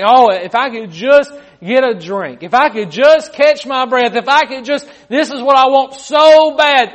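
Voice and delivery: loud, high, urgent craving; desperate pleading. Acted. Winded runner longing for a drink of water, desperate pleading. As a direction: beg for it at full force.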